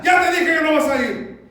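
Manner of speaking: high, full-volume fatherly outburst; angry shout. Acted. Father losing control with his child, angry shout. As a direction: lose it and yell the refusal. Father loses temper in quarrel.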